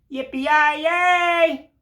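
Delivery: high, twangy mock cowboy whoop; cowboy yell. Cowboy snowman cheer.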